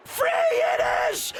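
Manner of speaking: very high, slow yelled count; ecstatic shout. Acted. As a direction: belt out the final count. Title win count climax.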